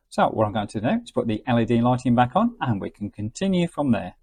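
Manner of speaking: normal talking voice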